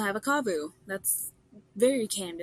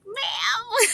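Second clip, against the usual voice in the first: exasperated ma'am; 14 semitones higher, 6 dB louder than usual. Retold or imitated. Pushy saleswoman won't stop selling.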